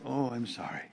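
soft, insincere wifely sympathy; feigned sympathy. Retold or imitated. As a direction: imitate a wife's hushed, dutiful apology. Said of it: "But inside, you're rolling your eyes".

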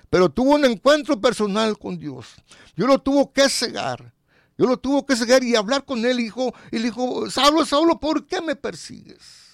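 high, loud preaching, slowing on the last line; preaching at full force. Acted. Preacher voices God calling Saul, loud and high. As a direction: proclaim it like a revival. Preacher tells Saul's conversion.

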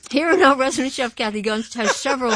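usual speaking voice

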